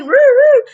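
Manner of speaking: high, loud mock police siren; siren imitation. Imitating the cop's siren behind her. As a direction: wail like a cop car's siren.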